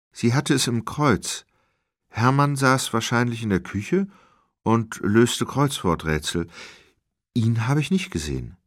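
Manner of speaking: high-pitched woman character, answering questions; voice of the woman witness. Acted. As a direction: raise the pitch for the woman, unhurried. Narrator voices Nini.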